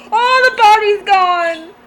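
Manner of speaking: loud, high, stretched-out wail; alarmed outcry. Real, not acted. Player reacting to a horror game scare, alarmed shout. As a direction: cry it out slowly at full volume.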